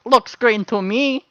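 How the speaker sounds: goblin voice. Acted. Goblin insists.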